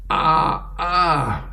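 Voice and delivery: high, mock "ah"; wordless open-mouth cry. Husband trying to get his wife's attention, high open-mouthed cry. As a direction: imitate a jaw stuck wide open.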